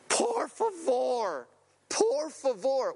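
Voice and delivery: high, drawn-out imitated cry for help; mock desperate scream. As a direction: imitate a drowning friend's scream, without full volume. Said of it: "I hear him screaming".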